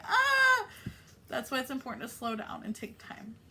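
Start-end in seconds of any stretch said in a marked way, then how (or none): 0.0-0.7 mock startled ah. Self voiced frightened.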